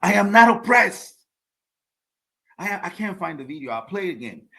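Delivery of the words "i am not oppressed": imitating an African accent, higher and louder; mock the Cameroonian woman in a raised African accent; loud, high imitation of an African accent. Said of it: "in her African accent".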